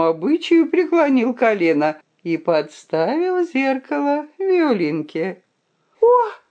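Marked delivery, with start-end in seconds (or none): 6.0-6.4 high, loud cry of shock. A narrator's reading. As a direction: gasp out in horror. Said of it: "крикнула она".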